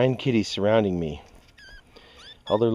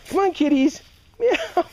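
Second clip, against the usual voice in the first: high kitty call; slower than usual. Real, not acted. Calling kittens.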